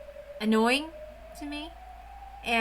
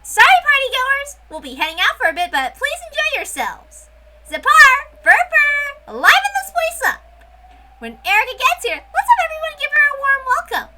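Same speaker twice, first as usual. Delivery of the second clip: much higher and louder witch, calling to the whole party; commanding witch hostess; 10 dB louder than usual. Acted. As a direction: play the witch hostess giving orders to the room. Witch character addresses party.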